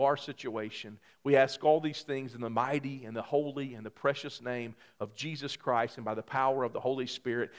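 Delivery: soft, reverent closing of the prayer. Acted. Pastor closes the prayer, soft and reverent. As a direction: close the prayer quietly, in Jesus' name.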